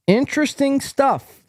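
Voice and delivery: high, punchy exclamation; hammed-up comment readout. Host reads a viewer comment aloud, hammed-up. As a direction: pitch it high like a big announcement.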